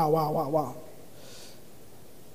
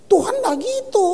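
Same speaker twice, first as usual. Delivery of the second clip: emphatic high denial; 10 semitones higher than usual. Real, not acted. Preacher to congregation, emphatic high denial. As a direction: cry out that God is not like that. Preacher rejecting fear-based church.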